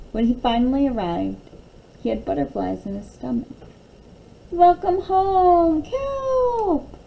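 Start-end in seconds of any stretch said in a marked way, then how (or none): 4.5-7.0 slow, high, loud greeting called out; joyful welcome. Acted. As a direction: cheer him home, drawing it out. Friends greet Kelp.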